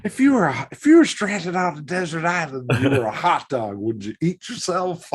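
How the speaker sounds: hammed-up announcer posing a silly hypothetical; Harry Caray. Co-host joins in the sportscaster sketch, hammed-up impression. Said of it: "Harry Carey".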